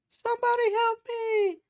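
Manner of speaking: eerie very high-pitched drawn-out call; high, slow falsetto plea on one note; imitated cry for help. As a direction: mimic a distant high wail for help. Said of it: "Just like that".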